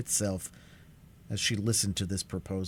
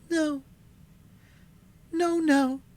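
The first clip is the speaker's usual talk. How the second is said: high, louder, desperate refusal; young sister refusing; 7 dB louder than usual. A narrator's reading. Alice refusing the bargain, high and desperate.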